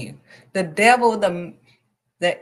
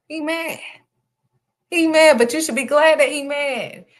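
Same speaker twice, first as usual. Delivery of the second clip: mocking gloat; 10 semitones higher, 4 dB louder than usual. Real, not acted. Preacher gloating at the devil.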